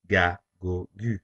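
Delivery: "Ga, go, gu" each with a hard G as in go; hard G syllables. Shown as a demonstration. Teacher demonstrating the hard G before a, o, u. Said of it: "the G will be pronounced as G".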